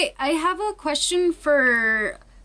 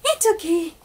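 soothing a scared dog; 4 semitones higher than usual. Real, not acted.

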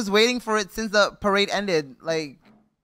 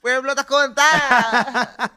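loud, drawn-out mock call; laughing crowd cry; 8 semitones higher, 9 dB louder, much slower than usual. Guest re-enacts the parade crowd, loud laughing call. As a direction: call it out high and slow through laughter.

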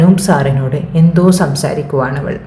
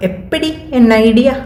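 smug boast; 8 semitones higher than usual. Acted. Kiran boasting in mock Tamil.

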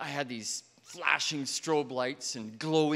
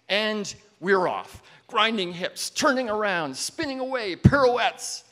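higher and louder with each move, peaking on the last; building excitement; 7 semitones higher, 7 dB louder than usual. Retold or imitated. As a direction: whirl upward into a loud, high climax. Acts out the dance whirling away.

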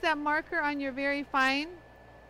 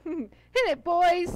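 laughing call; 4 semitones higher, 6 dB louder, slower than usual. Real, not acted. Host repeating her cue to viewers, laughing. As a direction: laugh while calling the cue again.

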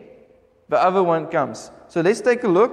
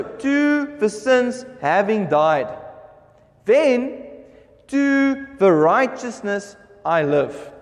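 slow, measured Bible reading; scripture reading; much slower than usual. Acted. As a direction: read the verse out with drawn-out, weighted words. Reads scripture aloud to congregation.

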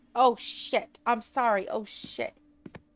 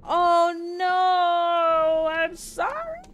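high, loud mock wail of oh no; hammed-up despair at the ending; wailing apology; 7 semitones higher, 8 dB louder, much slower than usual. Player wails a hammed-up apology to the game, high and loud. Begging after killing game character.

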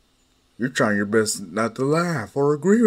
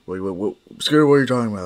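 usual speaking voice

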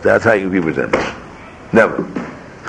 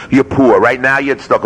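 ordinary delivery

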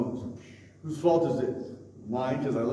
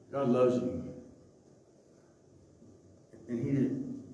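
normal talking voice

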